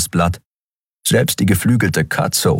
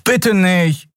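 high-pitched desperate plea; pleading; 13 semitones higher than usual. A narrator's reading. Miko begs Nelen over a card game, pleading. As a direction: beg in a much higher voice. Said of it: "flehte Miko".

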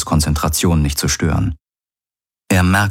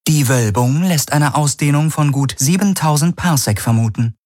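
much higher, even computer read, siebentausend stated matter-of-factly; ship computer; 9 semitones higher than usual. Acted. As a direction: announce siebentausend like a calm onboard AI. Ship computer reports size.

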